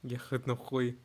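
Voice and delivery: soft Russian swearing; low-key Russian impression; Russian accent. Shown as a demonstration. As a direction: curse quietly in a Russian accent. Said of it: "a higher Russian accent".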